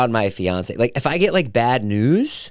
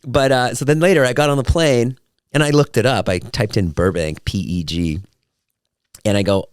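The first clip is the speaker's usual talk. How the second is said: usual speaking voice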